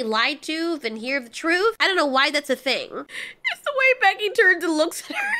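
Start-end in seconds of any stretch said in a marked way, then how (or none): 2.9-5.4 real high, cracking-up laughter through the words; laughing while talking. Real, not acted. Recapper cracking up at the friend's look, laughing speech. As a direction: try to explain through a fit of laughter.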